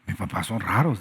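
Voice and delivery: mock the child who finds their parents weird; lightly acted complaining son; griping child. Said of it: "dices tú".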